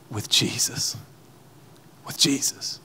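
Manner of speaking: breathy hushed emphasis on Jesus. Real, not acted. Preacher to congregation, hushed and reverent. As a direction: drop to a hush as if in awe.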